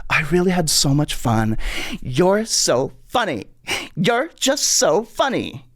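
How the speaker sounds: slow, high, lightly imitated gushing; gushing Paula. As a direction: lay the compliments on slowly and sweetly. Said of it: "she was like".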